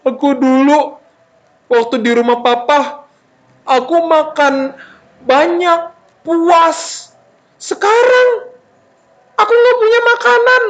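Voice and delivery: high-pitched, halting mock lament; sad wailing. Hungry son remembers food at papa's house, wailing sadly. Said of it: "Terus dia sedih".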